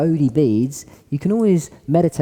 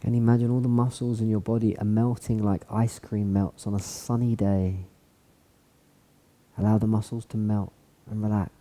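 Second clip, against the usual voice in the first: guided meditation; 3 semitones lower, 6 dB quieter than usual. Acted. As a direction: melt them into calm. Guides a body scan relaxation.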